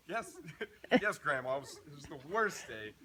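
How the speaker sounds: loud, high, worked-up complaint; exasperated venting. Retold or imitated. Telling grandma about the awful day, worked up and loud. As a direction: pour out the bad day in a rush.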